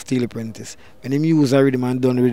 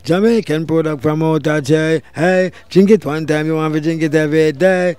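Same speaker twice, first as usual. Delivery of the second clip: commercial jingle; 3 semitones higher, 7 dB louder, slower than usual. Retold or imitated. Artist recalling his soda commercial, loud upbeat ad pitch. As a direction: sell the soda like a hyped announcer. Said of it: "I come here and say".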